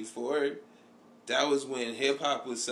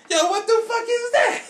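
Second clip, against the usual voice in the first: high, loud, incredulous yell; imitated comedian's shout; 8 dB louder than usual. Retelling a comedian's act to a friend, mock shouting. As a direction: yell it high, mimicking a stunned comic. Acting out comedian's reaction on stage.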